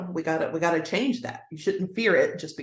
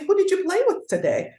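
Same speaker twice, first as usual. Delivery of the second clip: high, sing-song mom question; chirpy after-school question to a little one; parent asking child; 13 semitones higher, 4 dB louder than usual. Retold or imitated. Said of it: "or I'd say".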